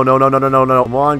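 ordinary talk, nothing marked

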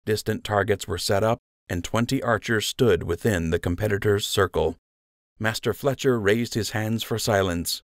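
usual speaking voice

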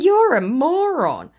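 high, loud, drawn-out jeer; re-enacted exasperated taunt; scornful insult. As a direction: stretch the insult mockingly. Acts her insulting reply.

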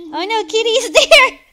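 loud, high shout with a laugh in it; giggly game panic; alarmed warning. Real, not acted. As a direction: yell the warning, half laughing. Warns of monster, half laughing.

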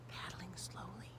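unvoiced hushed whisper. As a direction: breathe it while sneaking. Sneaking, repeated whisper.

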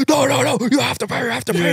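high-pitched mock yelling without real volume; shrill-pitched imitation of a screaming driver; retold screaming. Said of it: "screaming, like".

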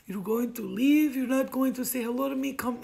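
upset suitor. Retold or imitated. Suitor upset that she is leaving, protesting. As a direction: imitate his hurt disbelief. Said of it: "He got really upset".

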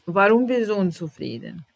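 ordinary talk, nothing marked

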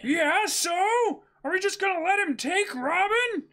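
ready to fight; defiant Luffy. Acted. Luffy argues with his crew, defiant.

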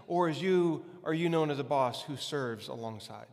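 usual speaking voice

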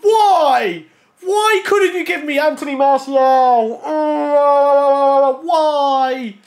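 high, loud wail of disappointment, dragging out the words; anguished wailing. Real, not acted. Gamer wailing at an unwanted player from a pack, anguished. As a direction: protest in drawn-out, pleading agony.

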